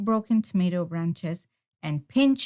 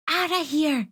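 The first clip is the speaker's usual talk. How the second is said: mild high shout at the birds; 7 semitones higher than usual. A narrator's reading. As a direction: shoo the turkeys off. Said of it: "he shouted".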